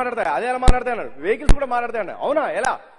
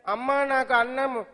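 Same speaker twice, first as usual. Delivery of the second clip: put-on child voice; 4 semitones higher, much slower than usual. Son asking mother for food.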